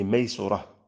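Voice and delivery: ميسورة said with "u" after the sin, maysurah; damma on the sin. Shown as a demonstration. Teacher giving a word's three vowelings, damma in ميسورة. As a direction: sound ميسورة as may-SU-rah. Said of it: "وبالضم".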